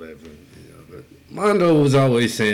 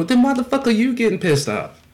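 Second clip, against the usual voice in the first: high-pitched, indignant acted comeback; heated comeback; 11 semitones higher than usual. Re-enacting his argument with Chuck, heated comeback. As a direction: throw the question back, pitch raised. Acted quarrel in retold story.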